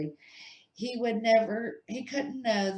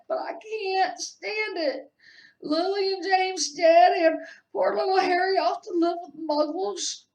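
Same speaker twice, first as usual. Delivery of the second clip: sobbing wail; 13 semitones higher, 9 dB louder than usual. A narrator's reading. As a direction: wail it through tears. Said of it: "sobbed Hagrid".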